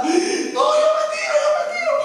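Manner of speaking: very high, piercing mock cry of refusal; imitating wailing daughter. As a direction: wail it while being held back. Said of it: "la tenían agarrada". (la hija gritando agudo que se tira)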